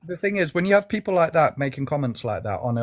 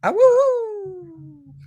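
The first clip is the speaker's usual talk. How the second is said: high, loud howl; wolf howl; 5 dB louder than usual. Acted. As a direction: throw your head back and howl like a wolf. Repeating the howl in chat.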